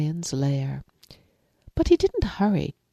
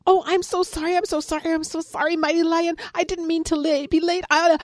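much higher, breathless pleading rabbit; frantic rabbit; 12 semitones higher, faster than usual. Acted. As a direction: squeak out apologies, flustered and late. Panting rabbit apologises.

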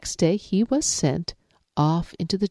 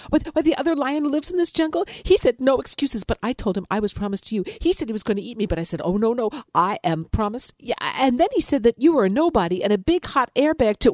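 much higher, fast gossipy rabbit; chattering rabbit; 7 semitones higher, faster than usual. Acted. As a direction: rattle off the tale to stir him up. Rabbit tells his lie.

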